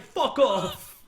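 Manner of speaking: loud, high mock-British yell; attempted British accent. New Yorker cursing at hell, accidentally British-accented shout. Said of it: "I don't know why I did the British one".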